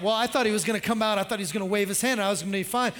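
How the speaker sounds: rushed whining Naaman. Retold or imitated. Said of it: "he's like".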